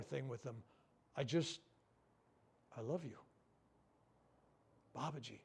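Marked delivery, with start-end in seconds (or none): 2.8-5.4 softer, lower, fading to almost nothing; quiet prayer. Real, not acted. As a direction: murmur a tender prayer, letting it die away. Praying over the dying man.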